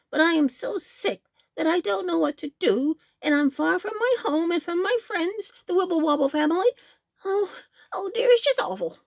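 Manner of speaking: high wailing, near crying; sick goose lament. A narrator's reading. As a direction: moan about feeling so sick. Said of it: "was the answer".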